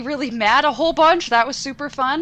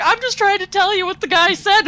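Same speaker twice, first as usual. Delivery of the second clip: higher, pleading husband; patient husband caught in the middle; husband's voice; 8 semitones higher than usual. Retold or imitated. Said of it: "he's like".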